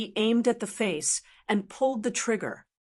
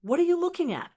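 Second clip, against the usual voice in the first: curious; 9 semitones higher, much faster than usual. Acted. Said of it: "He asked curiously".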